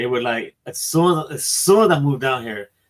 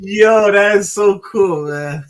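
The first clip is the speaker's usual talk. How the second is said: louder and higher, slowed down on the delight; 7 semitones higher, 6 dB louder, much slower than usual. Real, not acted. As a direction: savour how cool it is. Delighted reaction to beer name.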